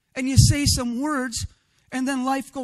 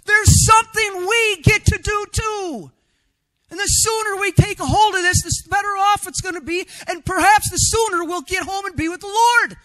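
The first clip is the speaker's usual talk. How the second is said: raised, higher pulpit voice pressing the point; fiery sermon delivery; full-force preaching; 6 semitones higher, 5 dB louder than usual. Acted. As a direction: preach it out to the congregation.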